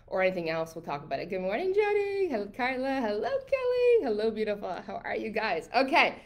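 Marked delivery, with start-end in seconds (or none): 1.3-4.7 high sing-song greeting. Real, not acted. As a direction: float the hello up high.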